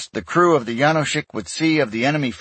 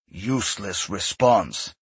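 slow, low-voiced growl; slow muttered growl of annoyance; growled under breath; 3 semitones lower, 3 dB quieter, slower than usual. A narrator's reading. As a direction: grumble it to yourself with contempt. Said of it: "Ye Sun growled under his breath".

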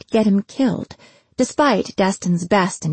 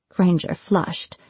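usual speaking voice

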